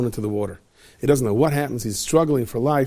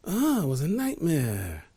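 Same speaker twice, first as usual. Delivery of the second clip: relieved sigh; 4 semitones higher, 6 dB quieter, slower than usual. Acted. King waking from dream.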